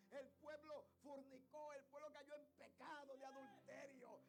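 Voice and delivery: builds from emphatic to high, loud shouting; rising pulpit fervor, slow and forceful; impassioned preaching. Real, not acted. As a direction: stretch the key words as the sermon climbs.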